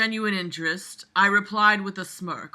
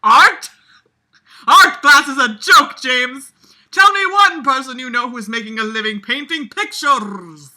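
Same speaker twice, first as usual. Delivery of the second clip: higher, much louder angry teacher yell; disgruntled shout; 4 semitones higher, 9 dB louder than usual. Acted. Teacher yelling at James about art class, angry shout. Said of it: "In a disgruntled tone ... the shouting".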